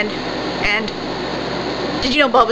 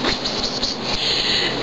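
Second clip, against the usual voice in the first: soft unvoiced pss hisses; quiet hissing pss noises; imitated pss; 5 dB quieter, much slower than usual. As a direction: breathe out little pss sounds. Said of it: "and I go pss".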